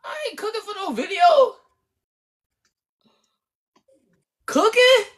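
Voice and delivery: squeaky, very high refusal; incredulous protest. Real, not acted. Balks at cooking on video.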